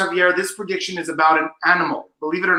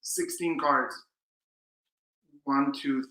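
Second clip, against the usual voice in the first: ordinary delivery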